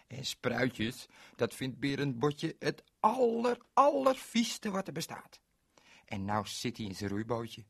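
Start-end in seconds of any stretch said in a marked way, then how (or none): 3.0-5.4 higher and louder on allervieste; disgusted. Acted. Narrator telling children, disgusted emphasis. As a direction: spit out how gross sprouts are.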